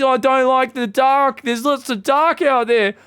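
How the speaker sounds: put-on high, slow, babyish fear; imitating a timid player's scared whine; mock scared whimper. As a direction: whine about the dark like a scared kid. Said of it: "Are you afraid of the map?".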